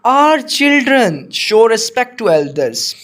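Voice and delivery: slow, deliberate dictation pace; slow classroom read-aloud. Shown as a demonstration. Teacher reads model answer slowly.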